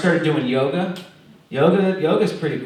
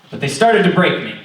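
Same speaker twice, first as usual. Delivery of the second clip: ordinary talk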